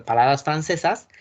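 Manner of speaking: ordinary delivery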